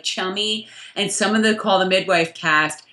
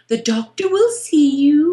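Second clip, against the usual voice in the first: higher, put-on receptionist voice; Sheila; 4 semitones higher, 4 dB louder than usual. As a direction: announce it in a lilting British impression. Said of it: "what's her name was just".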